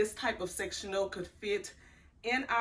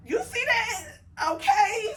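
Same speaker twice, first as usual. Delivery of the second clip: high, loud, laughing; 12 semitones higher, 7 dB louder than usual. Real, not acted. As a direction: laugh through the words. Laughing after rambling.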